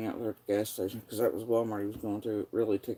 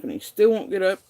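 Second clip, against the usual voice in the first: still, said higher and louder in exasperation; angry repeat; 8 semitones higher, 8 dB louder than usual. Real, not acted. Venting that her partner still won't get up, exasperated and louder. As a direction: hammer the repeated "still" harder.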